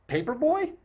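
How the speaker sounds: sharp high incredulous question; startled squeak of disbelief; surprised. A narrator's reading. Bodyguard asks in surprise.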